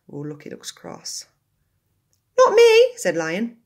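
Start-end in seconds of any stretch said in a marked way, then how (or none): 2.4-3.0 much higher, loud innocent squeal. Acted. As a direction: protest innocence loudly. Lion denies, children's story.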